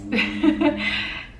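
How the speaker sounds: breathy, choked-up little laugh; teary laugh. Real, not acted. Telling her husband about their son, a teary laugh. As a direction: laugh through happy tears. Emotional, trying not to cry.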